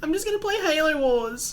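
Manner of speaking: put-on laughing whiny child voice; whining kid. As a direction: whine like a child dragged to school. Still in the kid voice.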